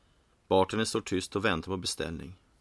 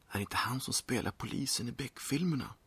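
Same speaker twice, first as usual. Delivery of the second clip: hushed, higher-pitched aside; whisper; 5 semitones higher, 6 dB quieter than usual. A narrator's reading. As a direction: lean in and whisper curiously. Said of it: "Bartendern lutar sig mot Peter och viskar".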